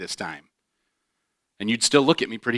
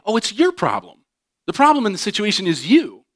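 mock blaming; 9 semitones higher, 5 dB louder than usual. Voicing blaming self-justification.

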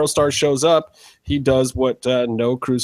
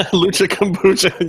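slow, higher, laughing through it; 6 semitones higher than usual. Real, not acted. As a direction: savour the pun while laughing. Repeating the pun, laughing.

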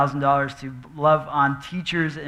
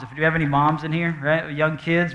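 usual speaking voice

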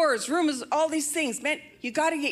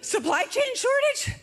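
mock disbelief; 12 semitones higher than usual. Mock disbelief at each new crisis.

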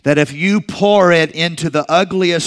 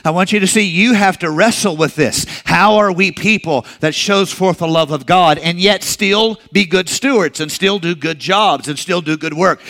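ordinary talk

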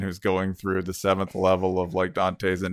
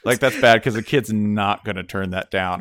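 ordinary talk

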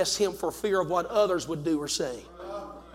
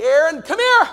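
raised, commanding summons; calling out; 8 semitones higher, 7 dB louder than usual. Acted. The Lord summoning Aaron at judgment, raised call. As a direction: call someone over with sudden volume.

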